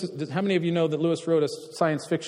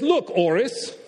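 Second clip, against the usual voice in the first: put-on British accent; 8 semitones higher, much slower than usual. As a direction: play the mayor in a British accent. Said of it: "I'll put on a British accent here".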